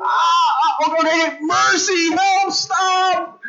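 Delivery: high, wailing cry for help; hammed-up shout of surrender; mock pained pleading. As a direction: yelp like a pinned little brother. Pinned brother begging for mercy.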